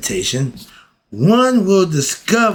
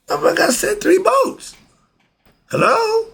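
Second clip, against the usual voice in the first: high, exasperated voice of God; God's reply. Acted. God tells the drowned man he sent boats, high and exasperated. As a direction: play God, fed up with the man's excuses.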